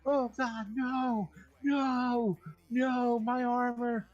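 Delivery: high mock panic wail; mock screaming friend. Friend losing his loot as monsters arrive, high mock wail. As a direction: wail over the lost loot. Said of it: "him screaming".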